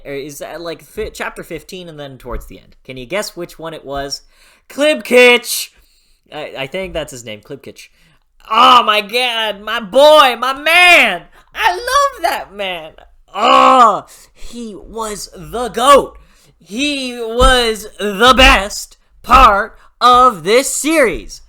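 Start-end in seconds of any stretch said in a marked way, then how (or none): 4.7-5.7 shouts the name, high and loud with joy; thrilled cry of the name; excited shout. Real, not acted. Gushing about favorite character. 8.5-21.4 much higher and louder, slow, fan squeals; overjoyed fangirl gush; ecstatic gushing. Real, not acted. Gushing about favorite character.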